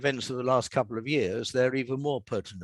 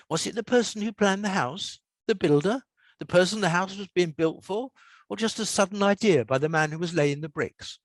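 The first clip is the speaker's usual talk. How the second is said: lightly voiced curious boy, a bit higher; boy's inner thought; 7 semitones higher than usual. A narrator's reading. Peter wondering at the brick arch, a light hint of a boy. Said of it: "he thought".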